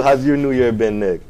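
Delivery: usual speaking voice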